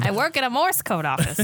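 high, mock vintage radio chatter; hammed-up old radio voice; old-timey reporter voice. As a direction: play along as an old-timey newsroom worker. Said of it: "all in that old-timey voice".

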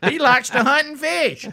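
high-pitched mock-approving country voice; hammed-up high Southern voter; gullible Southern voter. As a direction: gush like a won-over voter. Mock voters taken in by Southern act.

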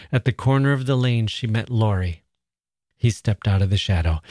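gentle storytelling read aloud; story narration. A narrator's reading.

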